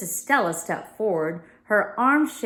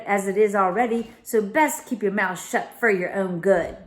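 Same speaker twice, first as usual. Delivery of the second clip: menacing servant, raised; Crowley recording; 4 dB louder than usual. Acted. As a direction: threaten her coldly on tape.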